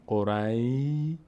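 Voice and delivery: "قری" drawn out slowly on the ay glide; pronounced ya. Shown as a demonstration. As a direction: hold the ی at the end of "قری", leaving off the sh.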